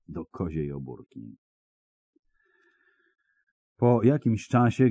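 ordinary delivery, nothing marked

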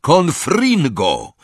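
high, slow, drawn-out incantation; yelled spell. A narrator's reading. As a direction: cry out the spell, stretching it. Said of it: "i wrzasnął".

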